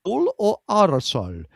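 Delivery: slow, drawn-out Swedish punchline; cheesy Swedish reveal; Swedish-accent punchline. Acted. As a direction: land the pun slowly in the Swedish accent. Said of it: "Great accent, though".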